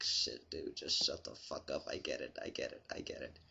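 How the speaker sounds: muttering so the cop won't hear; embarrassed mutter. Retold or imitated. Mortified teen under cop's teasing.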